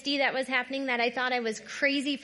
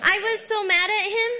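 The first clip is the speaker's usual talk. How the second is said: louder, with real heat in the voice; loud angry retelling; 6 semitones higher, 6 dB louder than usual. Real, not acted. Retelling her anger in the car.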